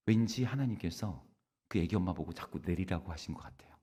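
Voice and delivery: ordinary talk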